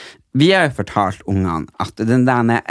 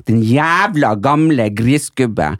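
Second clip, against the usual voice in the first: slow insult; much slower than usual. Retold or imitated. Insulting the old man, retold.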